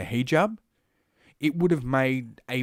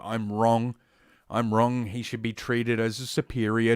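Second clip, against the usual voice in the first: ordinary delivery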